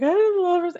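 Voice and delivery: high, loud overdramatic wail; hammed-up tearful declaration of love; mock-sobbing gush. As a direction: gush adoringly, as if melodramatically crying. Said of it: "I say that all, I'm like".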